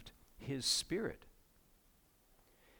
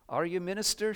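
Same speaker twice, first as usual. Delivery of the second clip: put-on higher-pitched voice for a little girl; lightly voiced girl asking at the door; little girl's question; 7 semitones higher, 4 dB louder than usual. A narrator's reading. Voicing child in read story.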